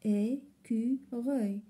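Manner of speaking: Écureuil slowly, cut into three beats; syllable by syllable. Shown as a demonstration. Teacher repeating to count syllables, syllable by syllable. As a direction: separate each syllable of Écureuil. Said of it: "Trois syllabes".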